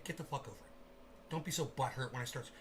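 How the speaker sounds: a quick hissed aside to the chat; whispered aside. Real, not acted. Streamer whispering at viewers who left, soft aside. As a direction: whisper it under the breath.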